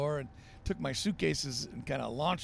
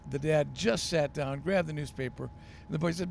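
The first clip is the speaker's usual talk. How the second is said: ordinary delivery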